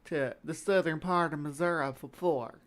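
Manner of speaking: put-on rural southern Missouri twang; southern Missouri accent. Shown as a demonstration. Correcting the narrator like a local, southern Missouri accent. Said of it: "say it like you're from the area".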